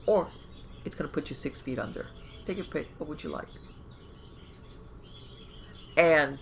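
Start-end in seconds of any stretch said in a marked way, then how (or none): none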